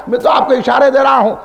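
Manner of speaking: normal talking voice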